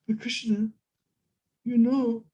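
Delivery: a hint of a tender maiden's voice; Radharani pleading. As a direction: beg him not to leave.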